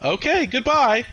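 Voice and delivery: high, loud, slow mock robot sign-off; automated robot lady. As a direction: imitate a blithely dismissive phone system. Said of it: "they're like".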